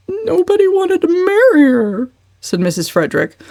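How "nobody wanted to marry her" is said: high, louder plaintive whine; plaintive mother. Acted. Mother answering Uncle Benjamin, plaintive. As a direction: whine it defensively. Mrs Frederick voiced high.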